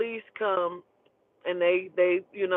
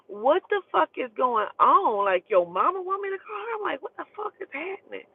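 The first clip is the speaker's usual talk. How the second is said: incredulous, rising higher as it goes; retold bewilderment; 7 semitones higher than usual. Reacting to a friend's mom wanting a call, bewildered. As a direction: voice climbing high in disbelief.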